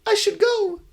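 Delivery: put-on embarrassed ghost girl close to tears; high-pitched shy ghost girl; high tearful ghost girl. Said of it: "The crying girl".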